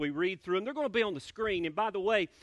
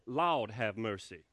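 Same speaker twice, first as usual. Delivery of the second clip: low, slow Southern "Lord have mercy"; drawn-out Southern "Lord have mercy", lower and slower; Southern idiom; 9 semitones lower, slower than usual. Shown as a demonstration. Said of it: "is actually short for".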